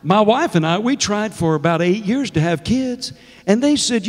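usual speaking voice

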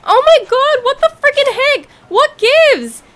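high, loud, furious rant at the game; frustrated screaming. Real, not acted. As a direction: scream at the dragonfly that got away. Raging at the dragonfly.

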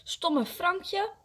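high, cross shouted insult; angry call. A narrator's reading. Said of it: "en roept".

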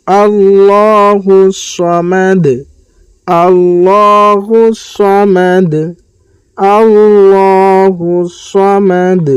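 slow melodic tajweed chant; measured Quranic recitation. Shown as a demonstration. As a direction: recite slowly, drawing out each vowel.

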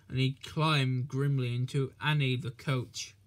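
plain reading voice

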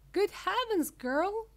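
high, loud startled shriek; sudden high-pitched outcry. A narrator's reading. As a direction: shriek it as a horrified mother. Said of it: "shrieked Missus Beauregard suddenly".

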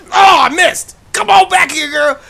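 high, loud chasing call; frantic shout. Real, not acted. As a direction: yell after her as she runs. Chasing a jogger in game.

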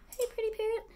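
high cooing coo at the bird; greeting her parrot in a high cooing voice; high pet voice. Real, not acted.

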